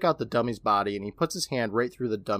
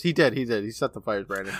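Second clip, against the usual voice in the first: usual speaking voice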